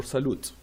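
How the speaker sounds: "salute" with the final T sounded; mispronunciation demo. Shown as a demonstration. Teacher warning about silent T, sounding it wrongly. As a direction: hit the T at the end of "salute". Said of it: "We never pronounce this T".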